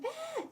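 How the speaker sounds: high mock scream of kids. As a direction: shriek like kids on the bus. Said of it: "you can hear the kids on the bus screaming".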